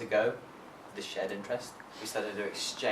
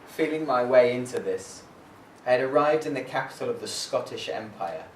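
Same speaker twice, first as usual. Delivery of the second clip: firm, louder prose reading; literary reading; 4 dB louder than usual. Acted. Poet reading Kokoschka's arrival in Scotland, firm reading. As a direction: read it like a traveller's diary.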